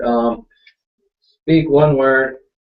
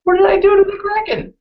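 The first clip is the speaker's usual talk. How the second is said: comic high-pitched outcry; high-pitched wail; 4 dB louder than usual. Acted. Player protesting about the Kraken's reaction, high wailing cry.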